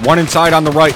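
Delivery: loud callout. Real, not acted. Firefight, calling out enemy.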